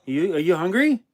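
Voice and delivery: usual speaking voice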